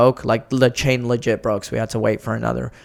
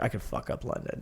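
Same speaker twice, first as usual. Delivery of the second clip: a low breathy whisper, almost under the breath; whispered boast; 4 semitones lower, 17 dB quieter than usual. Retold or imitated. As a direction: mutter it like a confident secret. Retold reply about London.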